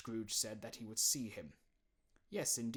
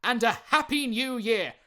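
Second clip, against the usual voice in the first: loud, higher, bright farewell; cheery nephew; 12 semitones higher, 17 dB louder than usual. Acted. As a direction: wish it gladly anyway.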